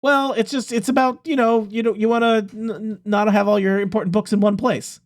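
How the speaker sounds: earnest NPC. Acted. NPC explaining rocket plan, higher voice.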